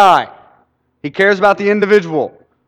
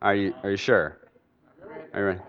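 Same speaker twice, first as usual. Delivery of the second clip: doubtful listener; 10 semitones lower, 12 dB quieter, faster than usual. Retold or imitated. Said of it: "some of you are like".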